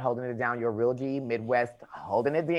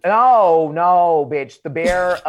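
usual speaking voice

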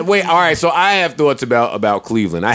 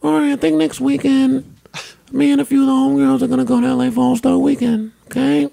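put-on higher Muppet-like girlfriend voice; squeaky put-on woman voice; man doing a woman's voice; 9 semitones higher than usual. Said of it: "Why am I dating you with this voice?".

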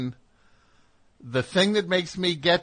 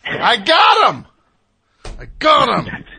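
triumphant shout; 9 semitones higher, 10 dB louder, slower than usual. Real, not acted. Radio host gloating over catching his caller, triumphant shout. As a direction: shout it out, high and loud, in triumph.

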